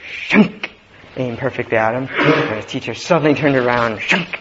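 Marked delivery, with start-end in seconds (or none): 0.1-0.7 sharp, loud "shunk" of an arrow; imitated arrow shot. Storyteller imitates the student's arrow flying, sudden sound effect. 4.0-4.3 quick "shunk" sound effect; imitated arrow shot. Storyteller imitates the teacher shooting back, sudden sound effect.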